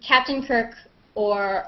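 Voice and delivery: normal talking voice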